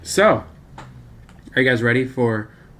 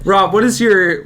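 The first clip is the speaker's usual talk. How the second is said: higher, louder, through giggles; laughing; 5 semitones higher, 5 dB louder than usual. Real, not acted. Host turns to Rob after the outburst, laughing. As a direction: push the question out while laughing.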